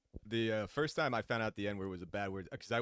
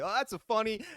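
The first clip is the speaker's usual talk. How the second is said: higher and louder, giggling; laughing kid; 7 dB louder than usual. Retold or imitated. Child watching Ninja Turtles, giggling.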